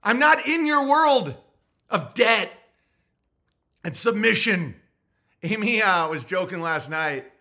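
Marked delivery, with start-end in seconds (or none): 0.0-4.8 high, loud, slow defiance; mock defiant shout. Refusing the form, a high loud defiant lord. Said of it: "I'm like".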